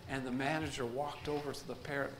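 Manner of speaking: ordinary delivery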